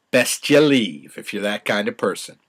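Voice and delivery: ordinary delivery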